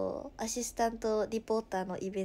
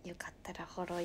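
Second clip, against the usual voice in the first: whisper; 5 semitones lower, 8 dB quieter, faster than usual. Real, not acted. Whispered follow request.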